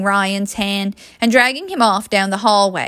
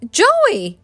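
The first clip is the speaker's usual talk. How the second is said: high-pitched shocked exclamation; aghast; 13 semitones higher, 4 dB louder than usual. A narrator's reading. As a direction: call out the name, appalled. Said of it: "Mary exclaimed, aghast".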